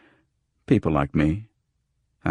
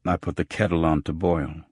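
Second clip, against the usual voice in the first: ordinary delivery, nothing marked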